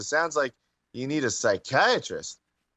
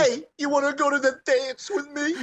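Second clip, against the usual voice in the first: much higher, laughing mock voice; 12 semitones higher than usual. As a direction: squeal it through laughter, high. Mocking voice, laughing.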